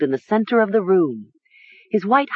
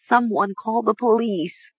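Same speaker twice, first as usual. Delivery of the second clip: higher-pitched, teary plea; tearful whisper; 5 semitones higher than usual. A narrator's reading. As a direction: plead through tears. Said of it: "Mara whispered through her tears".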